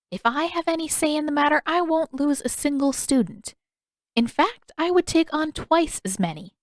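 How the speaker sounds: a hint of a cheerful teacher; kindly old healer. Old healer answering her student, lightly cheerful.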